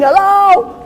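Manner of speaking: very high-pitched call; mock yell down from a height; imitated screaming. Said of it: "he's screaming".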